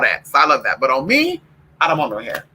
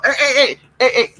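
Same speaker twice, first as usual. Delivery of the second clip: calling out; 8 semitones higher, 4 dB louder than usual. Real, not acted. Yelling at dogs.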